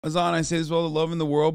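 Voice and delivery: ordinary delivery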